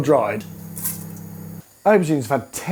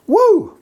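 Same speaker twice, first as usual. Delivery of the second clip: whoop; 6 dB louder than usual. Acted. Excited whoop.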